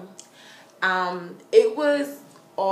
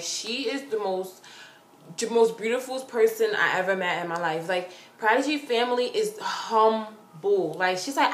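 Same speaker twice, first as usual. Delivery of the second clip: ordinary talk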